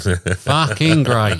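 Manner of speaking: slow drawn-out praise. Real, not acted.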